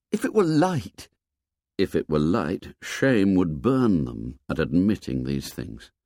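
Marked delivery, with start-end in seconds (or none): 0.1-1.1 put-on high-pitched questioning young character; boy's protest. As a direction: protest in a much higher voice. Twins' protest, then narrator echoes it.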